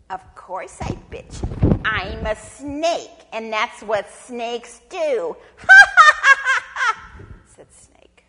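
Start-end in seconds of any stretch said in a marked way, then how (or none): 0.1-5.4 loud, slow, emphatic villain reply; gloating snake. Acted. Snake to bitten possum, loud unapologetic gloating. 5.4-7.5 high, very loud staccato laugh; villainous cackle. Acted. Snake having tricked possum, loud triumphant cackle.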